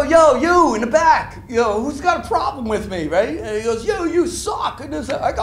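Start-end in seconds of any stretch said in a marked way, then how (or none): 0.0-3.4 loud, high call to the back of the room. Retold or imitated. Calling out to heckler in crowd. 3.8-4.8 high shout from the back; heckler shout. Retold or imitated. Retold heckler yelling from the back.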